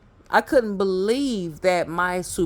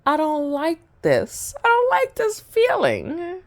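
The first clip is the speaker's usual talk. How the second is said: higher, uneasy thoughts; uneasy inner voice; 9 semitones higher than usual. Retold or imitated. Thinking it over on the toilet, rising and uneasy. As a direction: voice the creeped-out feeling. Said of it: "I was just thinking".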